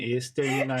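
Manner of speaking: flat robotic monotone. Retold or imitated. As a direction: drone it like a robot. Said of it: "quase falando como um robô".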